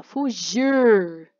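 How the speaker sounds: "Fugir" drawn out with a strong final R. Shown as a demonstration. Teacher showing the syllable-final R, "Fugir" with a strong, lengthened R. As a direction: lean hard on the R ending "Fugir". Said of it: "O som de R é forte no final da sílaba".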